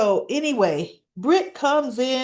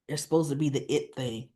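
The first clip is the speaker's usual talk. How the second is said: usual speaking voice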